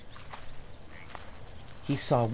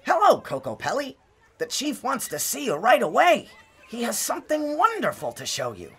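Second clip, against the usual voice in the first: put-on much higher, eager young man's voice; grinning, rush out the good news; young tribesman thrilled to fetch Kokopelli; excited young man; 3 dB louder than usual. A narrator's reading. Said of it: "the excitement in the young man's voice".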